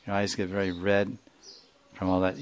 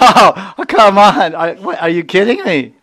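much higher and louder laughing disbelief; incredulous laughing protest, far above the usual voice; 10 semitones higher, 12 dB louder than usual. Acted. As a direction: burst out laughing at the idea. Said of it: "she starts laughing".

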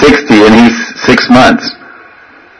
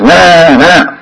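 put-on higher, drawn-out baby wail; imitated genuine crying; 8 semitones higher than usual. Child crying for its mother, imitated wail. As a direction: wail like a baby who really needs mother. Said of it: "within two seconds of genuine crying".

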